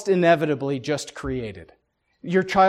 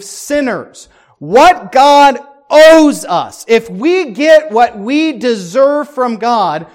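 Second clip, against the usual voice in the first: fiery preaching; 6 semitones higher, 10 dB louder, slower than usual. Real, not acted. Preaching at full force.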